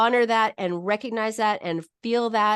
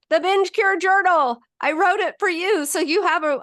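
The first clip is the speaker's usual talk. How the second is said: higher, louder sales plug; playful self-promotion; 9 semitones higher, 5 dB louder than usual. Pretend. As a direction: plug it with shameless, playful gusto. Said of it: "Shameless self-promotion there".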